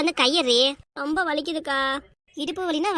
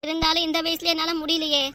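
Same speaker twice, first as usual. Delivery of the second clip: normal talking voice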